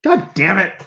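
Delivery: high goblin voice cracking into laughter; laughing through it. Real, not acted. Game master breaking character with laughter, high laughing. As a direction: lose it mid-character.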